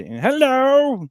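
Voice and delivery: high, loud mock greeting; Brad impression. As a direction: call it out high in his put-on voice. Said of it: "I think that's how he sounds".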